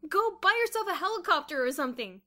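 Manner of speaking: high, loud dismissal; crying out. A narrator's reading. Boots refusing Kathy's check, loud cry. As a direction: cry out to send the money away. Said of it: "cried Boots suddenly".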